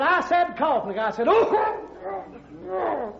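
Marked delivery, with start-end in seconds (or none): 0.0-0.8 loud command. Acted. Doctor hits patient, shouts. 1.3-3.1 pained yelp. Acted. Patient struck on the back.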